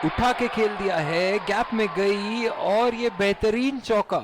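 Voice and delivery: excited cricket commentary. Acted. Boundary call in live commentary.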